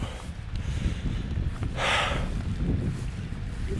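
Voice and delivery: long breathy sigh out; heavy sigh. Real, not acted. Vlogger remembering the deer in the park, heavy sigh. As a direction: let out a weary, emotional sigh. Emotional pause about the deer.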